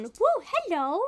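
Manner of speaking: high little woo for the pet; bright high hello to the cat; excited greeting. Real, not acted.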